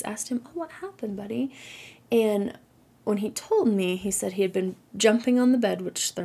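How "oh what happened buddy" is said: put-on higher, softer, tender question to a hurt child; gentle voice. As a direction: ask it as gently as you can. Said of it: "my best gentle voice".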